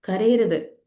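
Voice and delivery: put-on mild stern parent telling a child off; a hint of a strict elder saying no crying; scolding parent. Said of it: "പറയാറില്ലേ?".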